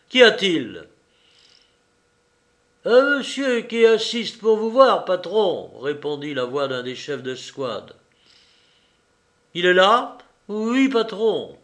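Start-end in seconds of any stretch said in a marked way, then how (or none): 0.1-0.9 loud call through the door. A narrator's reading. 2.9-5.7 much higher, louder subordinate; squad leader's voice. A narrator's reading. Squad leader answers through door. 9.6-10.2 loud, higher call; called question. A narrator's reading. Calling through door. 10.5-11.6 high, slow obedient answer; subordinate's reply. A narrator's reading.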